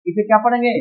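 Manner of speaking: normal talking voice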